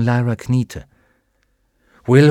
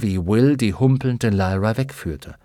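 plain reading voice

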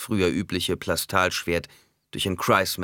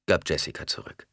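ordinary delivery, nothing marked

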